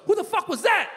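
high-pitched imitation of a boy; cussing young son. Young son asks dad about the caller, high mock-indignant voice. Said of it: "My son goes".

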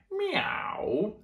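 high, rising sung meow; cat meow. Acted. Cats on the garden wall, high sung meow. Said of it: "sang the cats".

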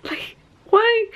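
high, pleading, on the edge of crying; close to tears. Real, not acted. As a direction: voice rising toward a sob. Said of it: "I wanna start crying".

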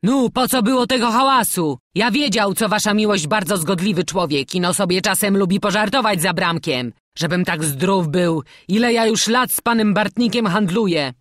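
wheedling merchant. A narrator's reading. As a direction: placate an angry customer, loudly. Merchant pleading with Bartnik.

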